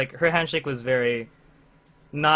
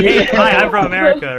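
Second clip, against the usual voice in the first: mock eager tourist; 7 semitones higher, 12 dB louder than usual. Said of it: "We're like".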